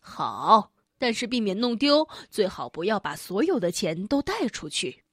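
low, calm parent voice; cautioning parent. Acted. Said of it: "叮嘱说".

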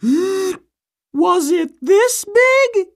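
slow, breath-held question; straining while puffed up. Acted. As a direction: ask while holding your belly puffed. Mother puffs up her belly.

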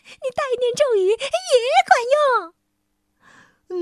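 high fast squeaky sprite. A narrator's reading. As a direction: chirp it out quickly. Sprite voice continues. (尖细的小精灵声音)

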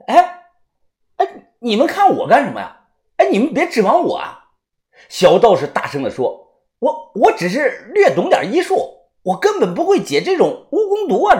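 high, flustered protest; loud. A narrator's reading. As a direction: back away from blame, loudly.

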